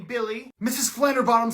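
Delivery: ordinary talk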